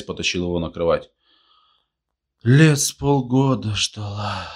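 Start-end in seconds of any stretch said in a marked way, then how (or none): 2.4-4.4 higher, slow, drawn-out wonder; drunken surprise. A narrator's reading. Tolik nods off over his story, drunken surprise. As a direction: marvel at it drowsily, stretching the words. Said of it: "удивился ещё раз Толик".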